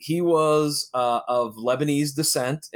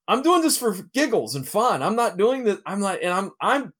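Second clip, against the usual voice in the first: mildly panicked, higher-pitched protest; retold freak-out; 8 semitones higher than usual. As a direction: replay your own nervous freak-out, pitch raised. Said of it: "I'm kind of freaking out".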